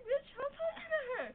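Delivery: high, loud, half-laughing snap at her friend; laughing mock yell. Cutting in on her friend on the phone, laughing loud mock yell. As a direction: bark it out while cracking up.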